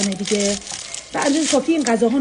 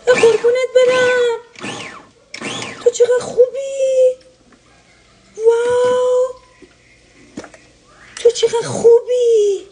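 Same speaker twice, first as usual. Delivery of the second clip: ecstatic squeal; 9 semitones higher, much slower than usual. Real, not acted. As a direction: gush over it like a dream come true. Thrilled by new kitchen gadget.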